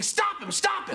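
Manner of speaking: a student imitating the roaring crowd for his teacher, high mock chant; crowd screaming. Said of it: "two thousand other voices screaming".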